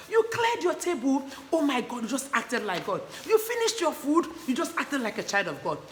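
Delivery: high, loud, delighted praise; excited praise. Shown as a demonstration. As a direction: celebrate every small good deed. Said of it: "repeating the things about God".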